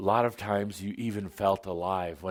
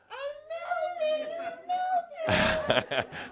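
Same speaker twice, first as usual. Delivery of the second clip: shrieking, dying witch scream with a laugh; witch's melting scream. Acted. Guest acting the melting Wizard of Oz witch, shrieking. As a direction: let out a high, wailing witch shriek. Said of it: "You do it so much better".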